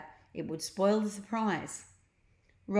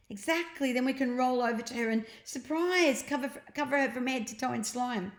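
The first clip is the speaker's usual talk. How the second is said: put-on eager boy, higher and quick; Ned, eager boy; 6 semitones higher, faster than usual. A narrator's reading. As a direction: push the plan on, excited. Ned voiced, scheming.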